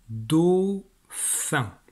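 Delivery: slow, stretched dictation in two separate syllables. Shown as a demonstration. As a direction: draw out each syllable for a pupil writing. Dictée découpée en syllabes. (dictée lente, syllabe par syllabe)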